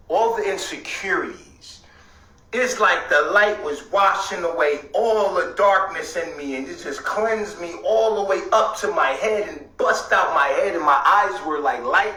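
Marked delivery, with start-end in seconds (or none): none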